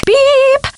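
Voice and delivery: high answering-machine beep; imitated beep. Professor pretending to be an answering machine, high beep. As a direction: mimic a long electronic tone, very high.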